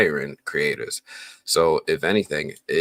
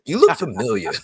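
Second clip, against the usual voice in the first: raised, louder Broadway-accent impression; Harold; 8 semitones higher, 5 dB louder than usual. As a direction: imitate a stage actor projecting, pitched up. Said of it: "he's got that Broadway accent".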